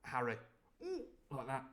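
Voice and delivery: usual speaking voice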